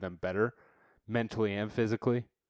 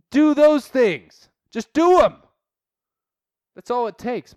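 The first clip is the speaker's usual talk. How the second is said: building to a high, loud shout; fired-up exhortation; 8 semitones higher, 8 dB louder than usual. Real, not acted. Host pumping up listeners, building to a loud high shout. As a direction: push harder and harder until you're yelling. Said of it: "I'm passionate and I'm pissed now".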